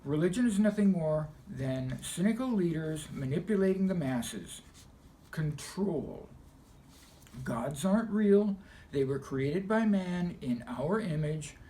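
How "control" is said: normal talking voice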